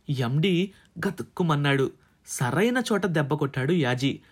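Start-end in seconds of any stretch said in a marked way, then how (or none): none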